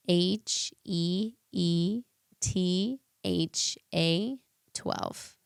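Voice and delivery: very slow, letter by letter. Real, not acted. Respelling an Instagram name for the host, very slowly. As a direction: spell it out slowly, pausing between letters. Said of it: "you gotta say it slower".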